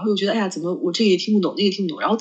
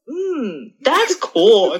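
very slow drawn-out that's cool; mock clueless reply; 3 semitones higher, 3 dB louder, much slower than usual. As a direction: fake interest while lost. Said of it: "然后你就会说". (慢吞吞地假装感兴趣)

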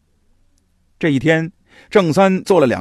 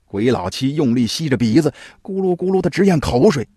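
usual speaking voice

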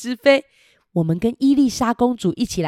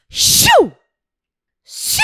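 whoosh sound; 8 dB louder than usual. Acted. Dragon flying sound effect.

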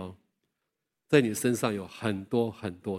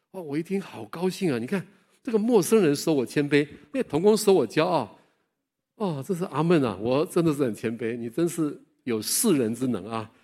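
louder, replaying his old delight; gleeful; 4 semitones higher, 6 dB louder than usual. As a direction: crow over the stranger's praise.

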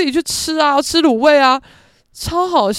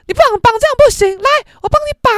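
much higher, louder fussing mom voice; bossy mother; 14 semitones higher, 8 dB louder, faster than usual. Retold or imitated. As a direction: play the pushy mom taking over the ponytails. Mother insisting on redoing ponytails.

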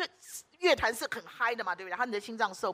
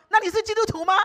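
excited question; 11 semitones higher, 9 dB louder than usual. Retold or imitated. Eager question to anaesthetist.

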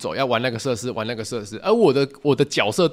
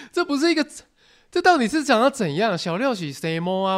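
laughing disbelief; 9 semitones higher than usual. Real, not acted.

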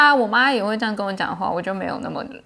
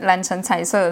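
usual speaking voice

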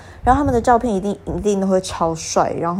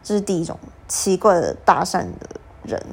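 usual speaking voice

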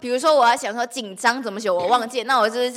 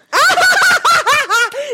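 high, loud shriek of laughter; 8 dB louder than usual. Real, not acted. Friend laughing at the mangled English, shrieking laugh. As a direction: explode into a squealing laugh.

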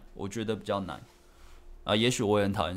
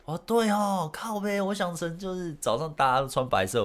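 much higher, self-mocking "oh right"; a replayed facepalm moment; retold realisation, pitched up; dawning realisation; 10 semitones higher than usual. Realising own mistake.

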